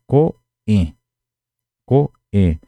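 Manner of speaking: "coen" cortado em "co", pausa, "en"; pronúncia cortada. Shown as a demonstration. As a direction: separe "coen" com um corte seco depois de "co". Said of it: "cortar mesmo a pronúncia". ("coen" com a pronúncia cortada no meio)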